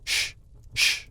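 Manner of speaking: whispered hushing; hushing shh. Acted. Hatter and Hare hush Alice with a whispered shh. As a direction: hiss a quick shh to silence her. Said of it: "went on".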